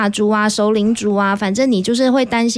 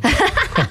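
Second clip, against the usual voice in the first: light laugh; 8 semitones higher than usual. Real, not acted. Laughing at joke about drivers.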